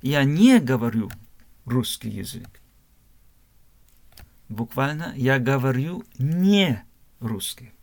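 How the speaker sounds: ordinary delivery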